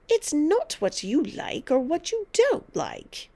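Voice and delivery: high, slow, cross emphasis; domineering grandmother overruling a child; snapped. A narrator's reading. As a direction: snap it out word by word. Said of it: "Grandma snapped".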